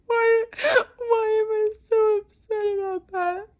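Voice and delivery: high, loud crying plea; real tears breaking the words; sobbing out the question, voice cracking high. Real, not acted. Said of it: "I don't know why I'm so upset".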